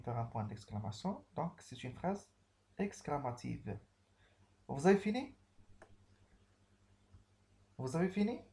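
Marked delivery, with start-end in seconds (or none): 4.7-5.4 high, loud question with a sharp rise; a bright, rising classroom question; questioning tune. Shown as a demonstration. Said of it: "Je pose une question". 7.8-8.4 high question rising on fini; ask, lifting the voice at the end; a clear rising question; questioning tune. Shown as a demonstration. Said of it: "Je pose une question".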